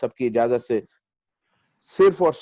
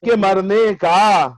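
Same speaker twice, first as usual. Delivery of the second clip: measured, higher mushaira declamation with lengthened vowels; ghazal recitation; 9 semitones higher, 5 dB louder, much slower than usual. Acted. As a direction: recite the verse grandly, drawing out each phrase.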